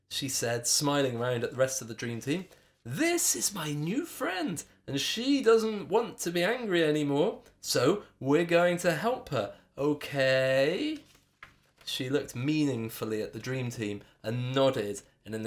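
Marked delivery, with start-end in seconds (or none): 2.8-11.0 pointed, meaningful. A narrator's reading. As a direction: say it with an exaggerated nudge. Meaningfully signalling the team.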